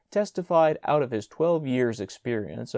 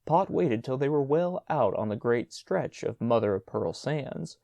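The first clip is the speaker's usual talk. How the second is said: usual speaking voice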